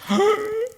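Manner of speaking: quick shocked intake of breath; sharp gasp. Acted. Preacher gasping at the thought of a sad giver. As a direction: gasp as if scandalised.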